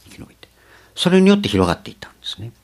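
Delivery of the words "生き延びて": 生き延びて breathed as a whisper; ささやき声で繰り返す; whispered echo. Acted.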